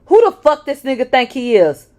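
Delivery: loud, high furious yell. Acted. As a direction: bellow it in jealous rage. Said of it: "as he yelled".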